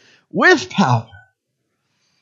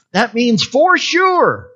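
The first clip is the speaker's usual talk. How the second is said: ordinary delivery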